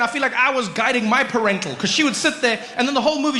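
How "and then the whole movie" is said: ordinary talk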